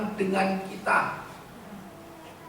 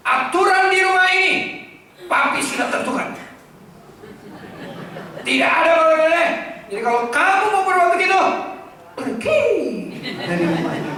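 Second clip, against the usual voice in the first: loud shouting ultimatum, higher and fast; tyrant father; 9 semitones higher, 8 dB louder than usual. Acted. Harsh father shouting house rules at his child, angry. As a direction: bark the house rules and throw them out. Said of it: "Jadi jangan gini, Pak".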